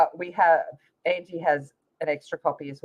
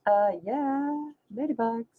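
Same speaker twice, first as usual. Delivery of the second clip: high, softer of-course tone; mock obvious; 5 semitones higher, 4 dB quieter, slower than usual. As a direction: say it like it's obvious. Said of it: "I was like".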